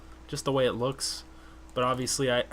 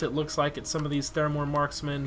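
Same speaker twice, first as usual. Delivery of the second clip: normal talking voice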